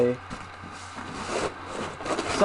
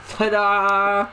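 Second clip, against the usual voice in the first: ta-da; 5 semitones higher than usual. Acted. Unveiling the box to viewers.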